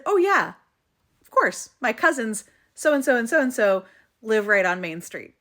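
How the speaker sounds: loud, high retold reply, settling in pitch; excited recognition. Stranger tells mom of his cousins there, acted excited recognition. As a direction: imitate someone delightedly knowing the place.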